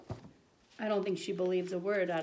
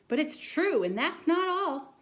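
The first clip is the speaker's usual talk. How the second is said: put-on high, louder kid protest; high childlike insistence; insistent boy; 8 semitones higher, 6 dB louder than usual. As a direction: plead it like a kid.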